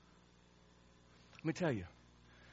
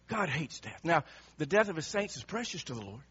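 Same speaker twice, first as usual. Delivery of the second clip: normal talking voice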